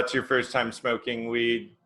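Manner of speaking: ordinary talk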